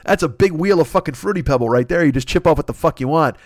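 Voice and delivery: usual speaking voice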